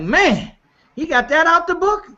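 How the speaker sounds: high, incredulous exclamation; amazed disbelief. Retold or imitated. To the group, remembering his own reaction: high amazed disbelief. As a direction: gasp it out like you can't believe the book had it. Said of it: "I'm like".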